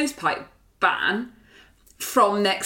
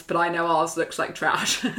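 ordinary talk, nothing marked

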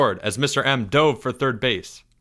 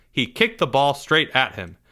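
usual speaking voice

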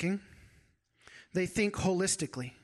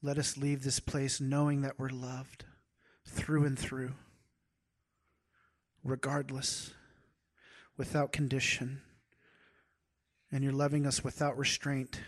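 closing prayer; 3 semitones lower, 4 dB quieter than usual. Acted.

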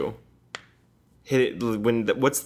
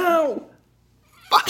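yelping in real pain; sharp cry of real pain; hit my funny bone; 4 dB louder than usual. Real, not acted.

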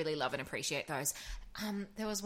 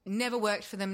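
usual speaking voice

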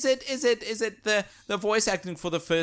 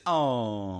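a low, deflated oh; disappointed oh; 5 semitones lower than usual. Retold or imitated. A crowd letting out a disappointed sigh. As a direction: let the air out of it, let down. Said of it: "just a disappointed".

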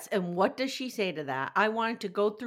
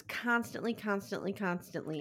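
each "constantly" softer, then trailing off; fading repetition; 7 dB quieter than usual. Shown as a demonstration. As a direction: repeat "constantly", fading away. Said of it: "repeated emphasis".

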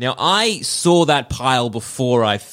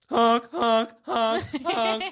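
high silly honks like a goose; goose honking; 7 semitones higher, 4 dB quieter, much slower than usual. Pretend. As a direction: honk like a goofy goose. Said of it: "we've all been Goose".

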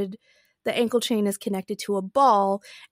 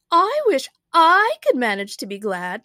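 higher, loud queenly voice; lamenting Queen; 4 semitones higher, 8 dB louder than usual. A narrator's reading. The Queen envies Alice being glad, loudly lamenting.

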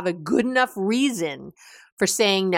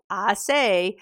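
normal talking voice

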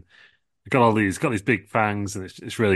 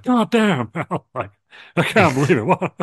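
high, loud cursing Stone Cold; Stone Cold impression; 9 semitones higher, 6 dB louder than usual. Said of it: "Stone Cold's like".

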